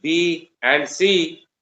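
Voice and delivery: slow, spaced dictation pace; dictating. Shown as a demonstration. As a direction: read it out word by word for writing. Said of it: "dictation to class".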